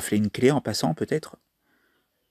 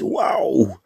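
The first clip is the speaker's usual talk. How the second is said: breathy, astonished whisper; amazed whisper. Real, not acted. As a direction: whisper it as if you can't believe the roll. Whispered reaction to the roll.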